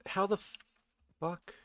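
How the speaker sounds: high-pitched startled swear; shocked disbelief. Real, not acted. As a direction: pitch jumps way up on "how the". Sudden in-game surprise.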